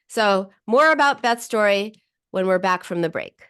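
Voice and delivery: usual speaking voice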